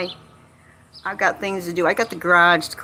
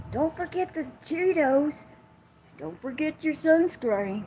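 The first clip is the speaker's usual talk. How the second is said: mock calling out; 8 semitones higher, 4 dB quieter than usual. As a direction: call after boaters in a half-hearted yell. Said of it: "Yell at 'em something".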